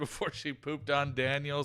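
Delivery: ordinary talk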